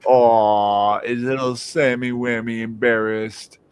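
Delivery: drawn-out, mock-pitying coo; retold slow patronising tease; teasing baby talk. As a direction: pity her like a toddler, slowly. Baby-talking embarrassed girlfriend.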